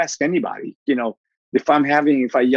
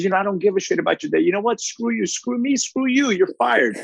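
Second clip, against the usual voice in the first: fast, higher ranting boss rant; mock angry boss; 8 semitones higher than usual. Boss firing a late worker, hammed-up fast angry tirade. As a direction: rattle it off like a fed-up boss.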